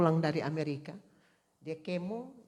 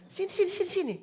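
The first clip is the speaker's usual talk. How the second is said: much higher quick repeated call; urgent calling; 12 semitones higher, faster than usual. Retold or imitated. Preacher calling the husband to the bed, urgent. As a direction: call him over, urgent and high.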